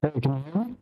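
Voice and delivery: muffled underwater mic sound. Real, not acted. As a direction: voice through a bad, muffled mic. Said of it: "It sounds like you're underwater".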